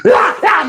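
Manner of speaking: high wailing cries; mock a little kid howling; imitated crying wail.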